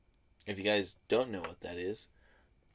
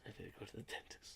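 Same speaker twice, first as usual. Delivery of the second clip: whispered aside; 20 dB quieter, faster than usual. Real, not acted. Muttered repeat to himself.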